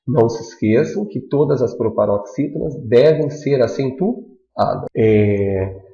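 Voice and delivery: ordinary talk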